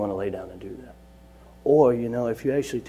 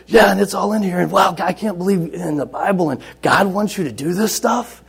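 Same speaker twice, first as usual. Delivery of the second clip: high, loud, amazed gushing; excited disbelief; 7 semitones higher, 8 dB louder than usual. Retold or imitated. Locked-up boy marvels at what God wants, loudly excited. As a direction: imitate a kid blown away by his discovery. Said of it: "the kid's excited and he can't believe it".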